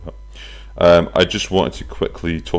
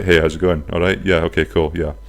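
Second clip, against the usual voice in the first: casual, unbothered greeting at usual pitch; nonchalant. Acted. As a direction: greet passers-by like you don't care about the job. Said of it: "he was just nonchalant".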